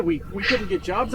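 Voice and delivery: ordinary talk, nothing marked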